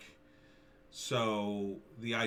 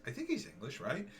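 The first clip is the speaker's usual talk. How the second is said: usual speaking voice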